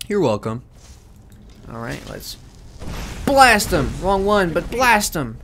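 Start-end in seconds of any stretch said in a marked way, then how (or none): 3.2-5.4 loud, high battle cry; loud shout. Real, not acted. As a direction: yell the command in battle. Shouting during combat.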